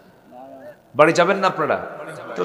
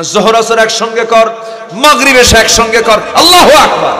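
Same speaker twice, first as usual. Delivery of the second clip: fiery preaching; 7 semitones higher, 11 dB louder than usual. Acted. Preacher urging the congregation, impassioned shouting. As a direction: thunder out God's promise.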